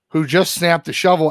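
ordinary talk

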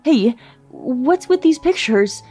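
slow, high, loud accusing question; slow loud furious question; angry demand. Acted. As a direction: demand an explanation for the photos. Husband sees evidence.

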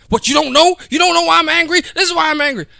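high, loud hammed-up angry woman; mock angry woman. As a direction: play the furious girlfriend, overdone.

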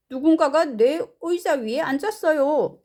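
lightly voiced, high-pitched character; indignant story father. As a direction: act out the father's complaint. Mr. Blair's line in translation.

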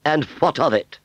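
high, dismissive retort; haughty fairground aunt. Acted. Aunt Sally unbothered by the revelation, haughty.

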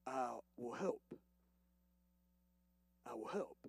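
hushed, reassuring voice of God; soft divine promise. Acted. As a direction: lean in and promise gently. God's promise voiced softly.

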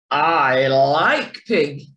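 low, slow and menacing; a low, drawn-out unfriendly wolf. A narrator's reading. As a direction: say it like a hungry threat. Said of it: "not in a friendly way".

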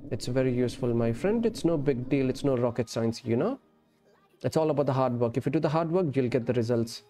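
ordinary delivery

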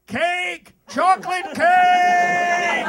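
high, drawn-out shout of Chocolate cake. Shown as a demonstration. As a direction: call out for cake like an emergency. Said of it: "You should be like".

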